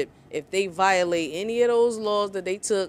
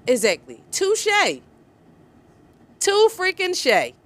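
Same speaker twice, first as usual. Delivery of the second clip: gleeful touché; 9 semitones higher, 4 dB louder than usual. Real, not acted. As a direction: savor the clapback. Cheering a comeback.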